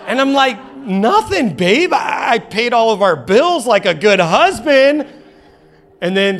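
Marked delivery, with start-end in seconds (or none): 0.8-5.1 high, loud mock indignation; defensive protest. Husband denying purchases to wife, hammed-up defensive protest. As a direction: protest innocence, slowing into comic whine.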